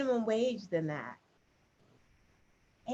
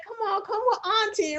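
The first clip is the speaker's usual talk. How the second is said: high, laughing mock lure; coaxing auntie; 10 semitones higher than usual. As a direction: imitate a creepy coaxing auntie. Mimicking creepy woman luring girls.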